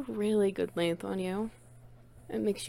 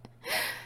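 breathy giggle; 6 dB quieter than usual. Acted. Caught the trick.

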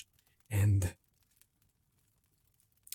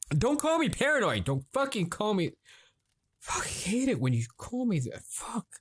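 shrieking up high at being called paranoid; high furious outburst; 10 semitones higher than usual. Acted. Husband lashing out at wife for calling him paranoid. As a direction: explode upward, voice pitched way high.

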